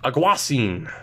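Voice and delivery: Aguasin as ah-GWAH-seen; another alternative pronunciation. Shown as a demonstration. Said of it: "But it could be".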